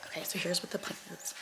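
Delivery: hushed morning whisper; library whisper. Real, not acted. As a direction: keep it whispered so nobody wakes. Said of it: "I'm kind of whispering because people are still sleeping".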